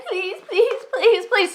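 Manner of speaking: high, whiny imitation of pleading; mock begging. [name] imitates the pastor begging to lead, mocking. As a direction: mimic his begging with contempt. Mimics the man begging her.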